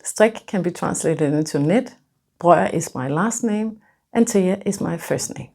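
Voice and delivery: usual speaking voice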